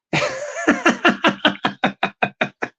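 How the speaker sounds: long high laugh, breaking into wheezes; wheezy, high cackle. Real, not acted. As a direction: crack up at your own act. Laughs at own act.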